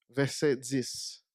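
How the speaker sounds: ordinary talk, nothing marked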